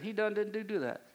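playful Southern accent, breaking into a laugh; joking Southern twang. Shown as a demonstration. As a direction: lightly put on a Southern accent, then chuckle. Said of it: "Southern accent".

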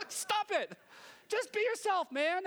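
high-pitched, laughing-edged protest; mock exasperation. Viewer to a preacher faking a drawl, mock exasperation. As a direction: plead in a high voice for them to drop the act.

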